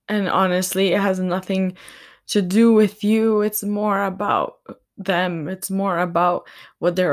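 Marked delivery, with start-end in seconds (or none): none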